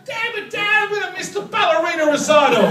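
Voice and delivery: put-on high, loud child's outburst; whining little boy. Little boy to Dad about missing his recital, loud protest. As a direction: protest loudly like an exasperated kid.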